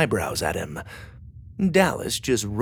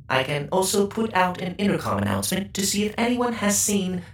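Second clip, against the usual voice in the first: polite, even robot voice; 9 semitones higher than usual. A narrator's reading. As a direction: speak like a helpful service robot. Chef bot robot speaking.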